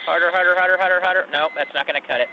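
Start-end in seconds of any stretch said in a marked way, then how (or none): 0.0-1.3 loud repeated urging; loud coaching. Real, not acted. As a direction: push him on like a coach. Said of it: "Now blow".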